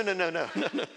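ordinary talk, nothing marked

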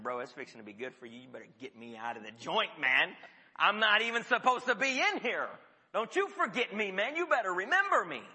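casual and fast, building to a loud demand; urgent street-talk plea. Retold or imitated. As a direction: grab him by the collar and insist. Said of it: "I don't think Joseph talked to him like that".

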